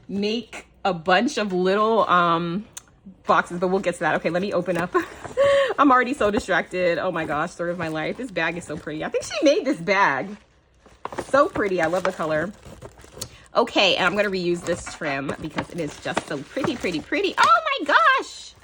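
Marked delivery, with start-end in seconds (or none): 5.0-5.7 high giggle. Real, not acted. Laughing at her own distraction. 17.4-18.5 high, loud, slow oh my gosh; excited gasp. Real, not acted. Opening the sender's handmade bag, a high swooning exclamation.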